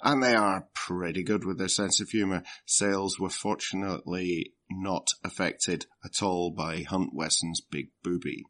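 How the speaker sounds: ordinary talk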